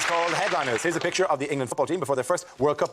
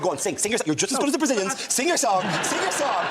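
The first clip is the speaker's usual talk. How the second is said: mock urging, climbing higher; pushy talent-show parent; 6 semitones higher than usual. X Factor parents pushing a child into audition, mock urging. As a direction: egg the child on with rising insistence. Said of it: "You're like X Factor parents".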